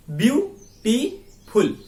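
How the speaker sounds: Beautiful slowly split as view, टी, फुल; three separated beats in beautiful; syllable by syllable. Shown as a demonstration. Teacher invites students to try saying it, syllable by syllable. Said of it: "बोल के देखिए".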